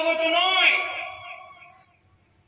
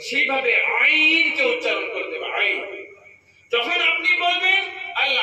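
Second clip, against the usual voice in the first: ordinary delivery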